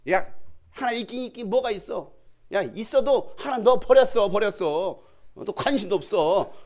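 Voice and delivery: loud, high scoffing; mocking jeer. Scoffers telling a believer God has left, loud mockery. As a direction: jeer at them, breaking into a laugh. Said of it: "비아냥하고 비웃고 조롱합니까".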